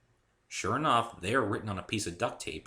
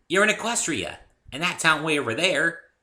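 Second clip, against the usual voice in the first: put-on much higher, louder giddy pony girl; bubbly Pinkie Pie; 9 semitones higher, 9 dB louder than usual. A narrator's reading. Cheerful pony introducing herself to Dave, high and bubbly. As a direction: chirp it out like an overexcited pony.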